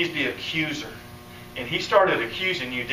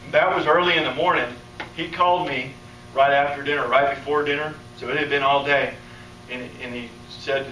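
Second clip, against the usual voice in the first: ordinary talk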